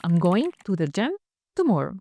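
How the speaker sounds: slow, clear answer for the learner; said slowly for the learner to copy; slow model sentence. Shown as a demonstration. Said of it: "هقول لك أنا". (جملة نموذجية ببطء)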